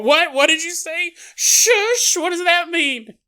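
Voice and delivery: high, loud confused yelling; clueless yelling in a hiding place. Acted. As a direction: shout your confusion, oblivious to danger. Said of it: "You're yelling".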